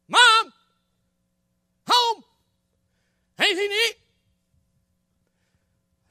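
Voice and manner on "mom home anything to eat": much higher, louder shout through the house; louder calling out. Acted. Boy to his missing mom, loud insistent calling. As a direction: yell for mom who hasn't answered. Boy calls again, no answer.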